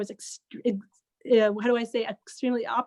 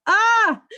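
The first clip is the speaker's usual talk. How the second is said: high, loud yelp of panic; a short scream of alarm; panicked yelp; 10 semitones higher, 8 dB louder than usual. Retold or imitated. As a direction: let out a sudden frightened cry. Told fifteen minutes to pray.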